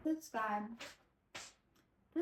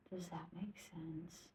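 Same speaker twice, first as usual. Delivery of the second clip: slow unvoiced whisper; 2 semitones lower, 13 dB quieter, slower than usual. Real, not acted. Tipsy storyteller whispering a slow aside to viewers. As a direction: breathe it out as a hushed aside, slowly.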